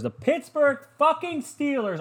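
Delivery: very high, slow, drawn-out mocking team name; mock announcer. Shown as a demonstration. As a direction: hype it like a sarcastic announcer.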